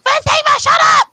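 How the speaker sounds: high, loud mock shouting; imitated yell. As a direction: yell it like your exasperated friend. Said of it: "when Joe was yelling".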